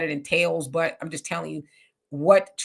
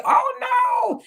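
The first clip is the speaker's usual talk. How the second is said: much higher and louder, whiny mock cry, slowing down; mock righteous wail; 7 dB louder, much slower than usual. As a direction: ham up a fake pious protest. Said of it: "I'm not gonna lie to y'all and say".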